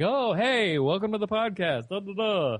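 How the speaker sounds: high-pitched, hammed-up podcast host greeting; radio voice. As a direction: parody your own on-air welcome. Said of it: "you totally do a radio voice".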